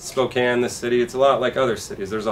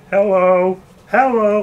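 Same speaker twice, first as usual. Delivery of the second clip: much higher, louder squeaky hello; 10 semitones higher, 8 dB louder, slower than usual. Retold or imitated. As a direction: overdo the proper hello. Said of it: "I was like".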